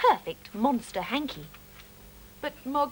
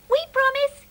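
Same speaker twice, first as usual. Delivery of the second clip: high mouse squeak, much higher and louder; 13 semitones higher, 8 dB louder than usual. Acted. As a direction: promise in a tiny squeaky mouse voice. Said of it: "squeaked as they waved goodbye".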